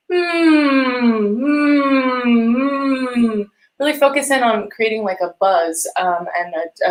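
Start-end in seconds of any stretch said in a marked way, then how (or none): 0.1-3.5 steady buzzing hum on the lips; hummed mm. Shown as a demonstration. Voice teacher demonstrating a lip buzz hum. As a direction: hum a forward mm to show the class. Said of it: "just make a mm sound".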